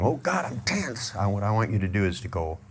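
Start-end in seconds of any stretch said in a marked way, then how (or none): 0.0-1.1 tense whine. Shown as a demonstration. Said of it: "So instead of going".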